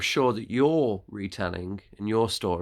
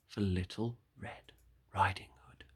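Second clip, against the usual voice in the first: 1 hushed whisper; 3 semitones lower, 7 dB quieter than usual. A narrator's reading. Narrator hushes before the wolf waits.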